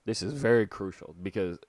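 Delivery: ordinary talk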